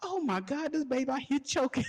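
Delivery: high alarmed mom voice. Retold or imitated. Mom frying fish sees the choking boy, high and alarmed. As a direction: squeal it like a worried mother. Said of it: "she's like".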